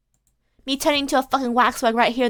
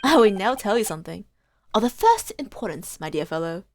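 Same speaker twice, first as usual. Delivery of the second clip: Holmes read; 5 semitones lower than usual. Acted. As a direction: lecture him like a sly gentleman sleuth. Voices Holmes in game dialogue.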